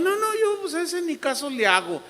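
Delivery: high, dismissive mock voice; a high caricature shrugging it off; mock careless believer. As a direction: lightly mimic someone who ignores the devil. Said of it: "O también".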